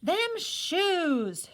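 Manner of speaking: high, loud roar over the shoes. A narrator's reading. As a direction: bellow it in outrage. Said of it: "she roared".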